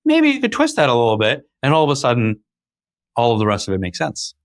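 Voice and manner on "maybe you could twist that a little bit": high, bright buttering-up voice, a bit hammed; flattering. Coaxing a colleague by praising their idea, mock flattering tone. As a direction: lay on the praise to win them over.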